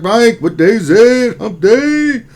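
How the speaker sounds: high, loud put-on hump day cry; hump day call. Host reads a chatter's message as a high loud hump day cry. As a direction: belt the chatter's line like a hype call. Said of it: "Squirt thirty says".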